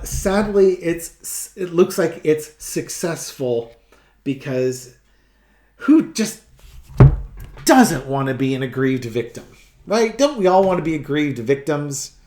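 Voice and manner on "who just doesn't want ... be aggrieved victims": mock-earnest rhetorical questions, pitched up at the start; heavy sarcasm, punching the openings; sarcastic. Real, not acted. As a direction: ask it with loud, exaggerated sarcasm. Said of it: "I'm being sarcastic".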